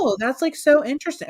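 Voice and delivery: high, gushing fascination; imitated keen interest. Bank manager reacting to customer's business, gushing interest. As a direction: mimic someone utterly fascinated. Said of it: "very interested in the whole thing".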